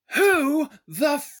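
high, loud, slurred start of a curse; drunken outburst. Acted. Drunk Hrungnir at the stranger, loud and high. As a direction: lash out before being silenced.

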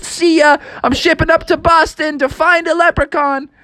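high-pitched, exuberant send-off; eager farewell. A narrator's reading. Frog leaving on a jet ski, high and excited. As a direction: call it out high as you zoom away. Froggy sets off happily.

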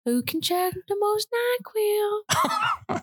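put-on high, sing-song creepy old man. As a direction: lure them in like a creepy neighbor. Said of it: "like the old creepy guy in Family Guy".

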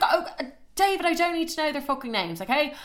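high-pitched indignant telling-off; exasperated protest. Retold or imitated. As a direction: cut him off, appalled. Snaps at him on the phone.

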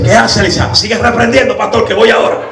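high, loud urging; excited old lady. Retold or imitated. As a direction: push the pastor on like an eager old lady.

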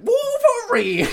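high, slow, loud Southern call of Wolverine; drawled greeting. Retold or imitated. The villain with the robot arm greets Wolverine in a high, loud Southern drawl. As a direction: stretch out the name in a big Southern drawl. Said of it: "southern drawl".

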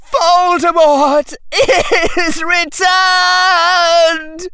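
high, anguished, halting shrieks; terrified seer wailing out a vision in bursts; crying out. A narrator's reading. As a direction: build to a long drawn-out scream. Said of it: "cried Harry Potter".

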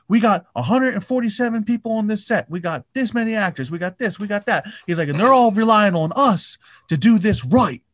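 high-pitched mock tirade, pressing on; imitated freak out. Podcaster mimics Tom Cruise screaming at crew. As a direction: lecture the crew like a furious boss. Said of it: "Dude, he's freaking out".

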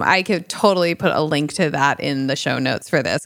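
ordinary talk

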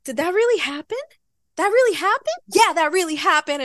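high, amazed "really happened?"; stunned she actually won; disbelief. Retold or imitated. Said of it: "and I'm like".

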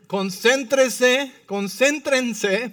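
loud, high, syllables drawn out slowly; emphatic command. Shown as a demonstration. Preacher reading the Bible's command to congregation, slow emphatic command.

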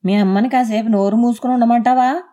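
husband loudly scolds his wife about her mother; loud scolding. Acted. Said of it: "గద్దిస్తూ గట్టిగా అన్నాడు".

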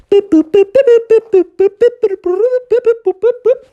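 high, quick electronic boops; chirpy robot bleeps; robot beeping. Acted. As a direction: beep out like a little machine. Robot reprogramming the other robots.

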